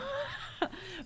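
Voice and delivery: a high, squeaky burst of laughter; high squealing laugh. Real, not acted. Laughs mid-story.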